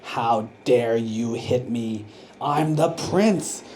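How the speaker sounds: slow, seething indignation; angry prince. Acted. Hit prince tells the teacher off, slow and seething. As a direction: drag out each word, simmering with offence. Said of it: "was so angry".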